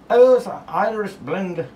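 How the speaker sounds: loud, slow hammed Irish accent. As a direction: belt it in a hammy Irish accent. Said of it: "that was an accent from Ireland".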